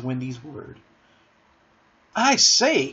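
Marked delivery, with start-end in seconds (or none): 2.2-2.9 high-pitched, eager call; excited cry. A narrator's reading. John has an idea for the others, excited cry. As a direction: pipe up with a bright idea. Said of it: "cried John".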